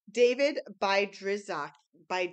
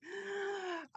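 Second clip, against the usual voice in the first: excited gasp; 12 semitones higher, 7 dB quieter than usual. Real, not acted. Excited gasp at a new book.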